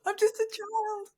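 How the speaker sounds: put-on high little-kid whine; childish voice. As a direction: say it like a small child.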